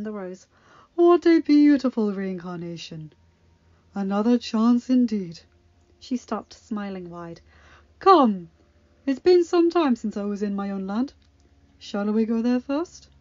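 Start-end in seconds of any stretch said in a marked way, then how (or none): 0.9-5.4 put-on loving elderly mentor; old teacher. Agatha marvels at the rose. 8.0-13.1 put-on loving elderly mentor; old teacher. Agatha speaks to the flower.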